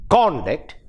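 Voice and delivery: CONduct with the weight on CON; first-syllable stress. Shown as a demonstration. Teacher explaining the noun or adjective, first-syllable stress on conduct. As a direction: punch the first syllable of conduct, let it fall. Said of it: "If the stress is on the first syllable".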